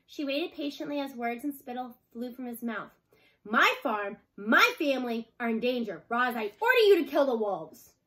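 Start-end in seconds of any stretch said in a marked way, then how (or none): 3.4-8.0 loud, raised farmer's shout; an angry farmer yelling at his robot; furious yell. A narrator's reading. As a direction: shout her down in anger. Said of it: "yelled at Roz".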